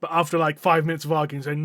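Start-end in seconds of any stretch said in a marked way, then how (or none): none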